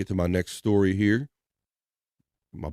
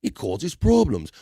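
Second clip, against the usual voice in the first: mock Aussie warning, pitched up; light Australian accent; 5 semitones higher than usual. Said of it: "at least in Australia".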